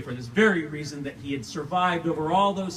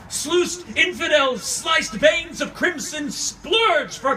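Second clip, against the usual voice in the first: loud, higher, raging cry against God; anguished shout; 13 semitones higher, 7 dB louder, slower than usual. Acted. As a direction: bellow it out in fury at God. Anguished climax of the monologue.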